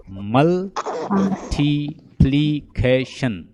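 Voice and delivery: "मल, थी, प्ली, ख, शन" one slow syllable at a time. Shown as a demonstration. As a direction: separate "मल, थी, प्ली, ख, शन" with clear gaps. Teacher counts syllables of the word.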